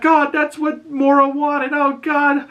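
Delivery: very high-pitched, hammed-up panic; mock freak-out. Shmi reacting to the bloody death, mock freak-out. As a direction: imitate a character losing it in a squeaky voice. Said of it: "he's freaking out".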